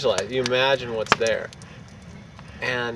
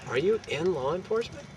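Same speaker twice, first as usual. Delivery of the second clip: lightly voiced dispatcher, higher-pitched; retold police dispatcher; 7 semitones higher, 6 dB quieter than usual. Dispatcher questioning the caller, lightly voiced in higher pitch. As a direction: imitate a puzzled call-taker, pitched up. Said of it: "And she's like".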